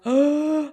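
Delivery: loud high gasp, then oh; surprised gasp into oh; sharp gasp of shock. Retold or imitated. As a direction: gasp as if hit by the news. Hearing two heartbeats.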